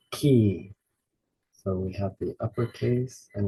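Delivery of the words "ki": "Ki" from the back of the throat; guttural sound. Shown as a demonstration. Teacher demonstrating the throat sound, guttural "Ki". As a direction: push out "Ki" with a guttural start. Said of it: "guttural sound from the back of your throat".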